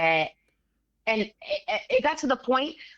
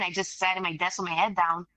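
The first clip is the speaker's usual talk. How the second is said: ordinary talk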